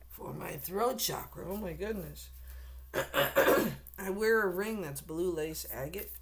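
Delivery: ordinary talk, nothing marked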